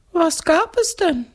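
very high, inquisitive ghost voice; curious ghost. A narrator's reading. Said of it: "fragt das Gespenst neugierig". (neugieriges Gespenst, hohe Stimme)

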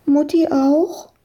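put-on high child's question; little girl. A narrator's reading. Found girl asks whether mum is searching too, childlike. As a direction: ask in a small, high child voice.

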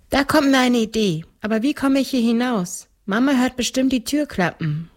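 put-on little girl. A narrator's reading. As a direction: speak up as a seven-year-old girl. Reader voices the little girl.

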